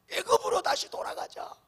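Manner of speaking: high rising call to turn back; crowd cry. Retold or imitated. The people urge a return to Egypt, a high rising cry. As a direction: pitch it up like a mob urging each other. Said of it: "그러면서 하는 말이".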